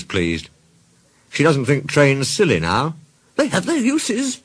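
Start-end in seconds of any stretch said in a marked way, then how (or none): 3.4-4.4 high-pitched put-on car voice; high storybook car; haughty old car. A narrator's reading. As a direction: concede it in a high character voice. Caroline concedes trains are useful.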